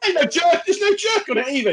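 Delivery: high, complaining protest; mock outrage.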